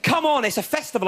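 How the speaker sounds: raver's line quoted back with a sneer; mocking the catchphrase. Comic retelling the raver's catchphrase, mocking. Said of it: "a bit of a catchphrase".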